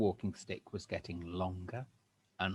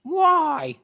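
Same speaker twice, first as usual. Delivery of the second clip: put-on much higher, loud, fussy old-woman voice; shrill old Missus Twit; 13 dB louder, much slower than usual. Missus Twit startled, answering her husband in a high old-woman voice. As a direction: play a flustered old hag. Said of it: "Missus Twit said".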